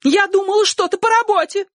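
squealing, very high female voice; shrill. A narrator's reading. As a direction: make excuses in a piercing high voice. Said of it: "визгливо сказала женщина". (визгливый женский голос)

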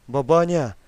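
loud call to wake her. Acted. Grandson calling to wake his grandmother. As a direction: call her name loudly through the dark. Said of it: "позвал он".

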